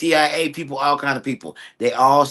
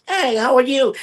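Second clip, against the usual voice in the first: higher, bright, bouncy "hey, how are you"; imitating the cheery reply; 9 semitones higher than usual. Guest acting out her friend greeting back, high. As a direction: play the eager American answering back. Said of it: "now I'm like".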